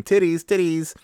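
high-pitched mock kid chant, not full volume; imitated kids' yelling. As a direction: mimic excited children calling out. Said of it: "both of the kids are yelling".

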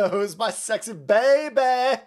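drawn-out creepy coo; creepy baby talk. Pretend. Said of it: "That's so fucking creepy".

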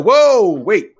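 loud, high "whoa"; loud interruption. Retold or imitated. Dad halting his son's self-blame, loud and urgent.

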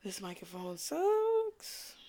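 whiny complaint. Pretend. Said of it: "I was about to be like".

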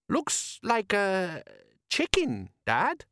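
high, halting, slow observation; baffled boy telling Dad; puzzled son. Acted. Son puzzled by rising object.

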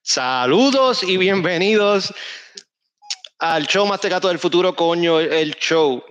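slow, drawn-out show host welcome; booming kids show opener. Acted. Podcast host opens the show. (presentador de programa, lento y retumbante)

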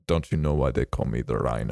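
put-on low, menacing Russian accent. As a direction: growl it like a Russian villain. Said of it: "in a Russian accent".

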